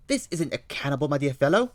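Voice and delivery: put-on high, loud London-accented Holmes; eager detective reading the news aloud. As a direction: play Holmes, keen and bright over the paper.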